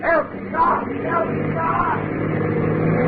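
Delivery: high desperate calls for help; crying for help. Acted. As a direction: yell for help as you're thrown out. Pilot pushed out, crying for help.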